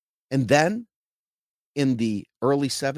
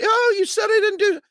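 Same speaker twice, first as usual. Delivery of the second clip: much higher, whining mock outrage; whiny offended voice; 4 dB louder than usual. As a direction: whine like someone deeply offended. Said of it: "because you say".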